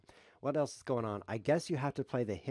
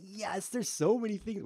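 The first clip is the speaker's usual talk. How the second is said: high, loud delighted burst; thrilled at all the captures; excited; 11 semitones higher, 6 dB louder than usual. Real, not acted.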